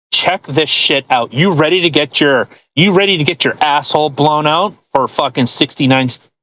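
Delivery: normal talking voice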